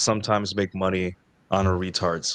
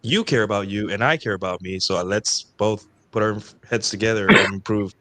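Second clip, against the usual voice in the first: ordinary talk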